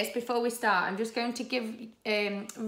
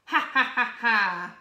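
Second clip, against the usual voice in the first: loud, staccato villain laugh; evil laugh; 3 semitones higher, 8 dB louder than usual. Acted. Evil pea gloating over its escape, evil laugh. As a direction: cackle like a storybook baddie.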